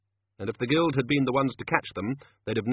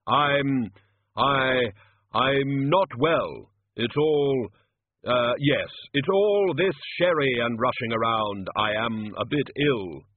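slow, louder, halting solemn voice; ponderous Death making excuses; 3 semitones higher, 5 dB louder, much slower than usual. Acted. Death explains his thin face to the wizards, slow halting solemn voice. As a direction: intone a faltering excuse.

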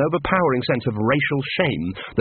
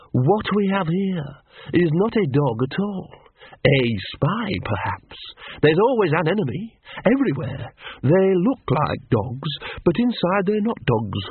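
Big Fido the poodle; 5 semitones higher than usual. Acted. Narrator voicing Big Fido.

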